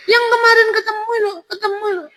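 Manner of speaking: quick, insistent falsetto; high hammed-up woman; woman's voice. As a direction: jog his memory in a very high female voice. Voicing the veiled woman.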